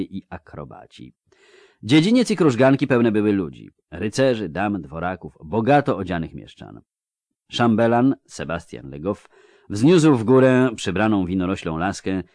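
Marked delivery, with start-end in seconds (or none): none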